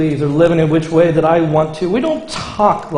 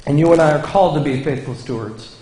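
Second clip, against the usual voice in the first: preaching; 5 semitones higher than usual. Acted. Pastor to the congregation, preaching at full force. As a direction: proclaim each line up high to the congregation.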